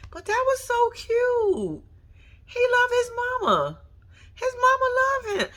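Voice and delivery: high, slow, tearful voice; fighting back tears; choked up. Real, not acted. Touched viewer crying over the son's love, tearful. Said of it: "getting all choked up".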